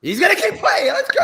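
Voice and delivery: high, loud hype shout; excited shout. Real, not acted. Host hypes player staying in.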